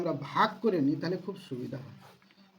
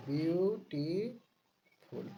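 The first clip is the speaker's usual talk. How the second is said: drawn-out repeat; much slower than usual. Shown as a demonstration. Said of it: "slow drawn-out repeat".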